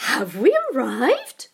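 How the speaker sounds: put-on high, slow, hopeful old woman's question; eager old lady. A narrator's reading. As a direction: ask it like a hopeful child. Confused old wife voiced.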